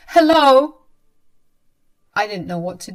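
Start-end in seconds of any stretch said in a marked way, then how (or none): 0.1-0.8 calling out. Acted. Calling out, locked in room.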